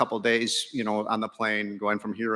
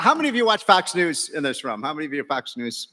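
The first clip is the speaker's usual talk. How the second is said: usual speaking voice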